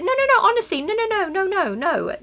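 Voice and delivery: high, repeated no, falling away; retelling refusing the boy's change, high and insistent; insisting no. Retold or imitated. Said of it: "I was like".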